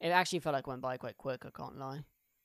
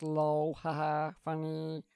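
ordinary talk, nothing marked

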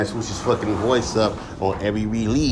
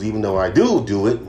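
normal talking voice

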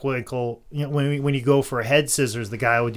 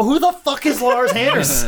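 high, incredulous yelp; baffled outburst; 11 semitones higher, 3 dB louder than usual. Retold or imitated. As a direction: shoot it up in total disbelief. Baffled retort to fans.

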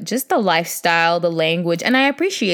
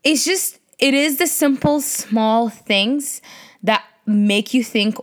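usual speaking voice

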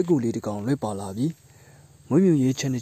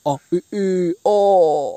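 rooster crowing; 6 semitones higher, 7 dB louder than usual. Acted. Rooster crows in animal story.

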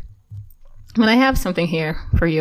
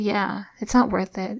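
softly retold reassurance; calm, soft agreement; 3 semitones higher, 6 dB quieter than usual. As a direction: keep it quiet and settling. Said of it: "very calm".

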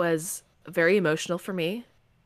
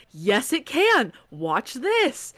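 taunting author; 5 semitones higher, much slower than usual. Retold or imitated.

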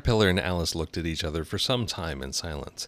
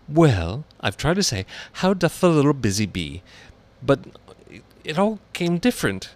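ordinary delivery, nothing marked

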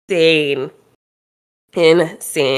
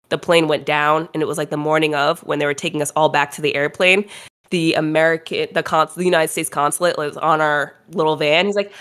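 usual speaking voice

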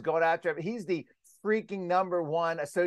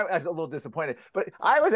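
ordinary talk

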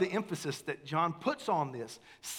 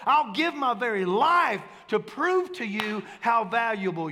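much higher, louder, ringing out; louder and higher preaching on God being all in; preaching at full force; 8 semitones higher, 7 dB louder than usual. Acted. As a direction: swing up into the climax and proclaim it. Climax of the sermon.

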